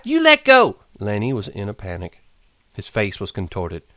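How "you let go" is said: crying out. A narrator's reading. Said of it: "she cried".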